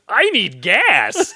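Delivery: slow, high, dim-witted; dopey voice. Retold or imitated. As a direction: say it like a dazed fool. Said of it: "He literally goes".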